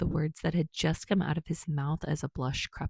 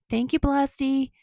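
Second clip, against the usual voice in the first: higher, louder happy farewell; cheerful call; 8 semitones higher, 5 dB louder than usual. Acted. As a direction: call it out brightly as he leaves. Kirishima calls after Bakugo.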